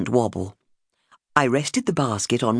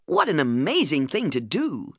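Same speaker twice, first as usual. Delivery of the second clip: amazed; 3 semitones higher than usual. Retold or imitated. Friends astonished at news.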